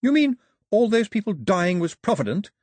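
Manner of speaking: loud, high indignant question; sharp challenge. A narrator's reading. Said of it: "said Daphne sharply".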